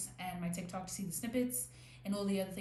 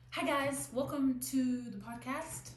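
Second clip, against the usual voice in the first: higher, louder, slow upbeat host greeting; podcast host intro; 6 semitones higher, 3 dB louder than usual. Shown as a demonstration. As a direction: greet the listeners like a bubbly show host. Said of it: "let's start again".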